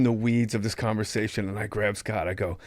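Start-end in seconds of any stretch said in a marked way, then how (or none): none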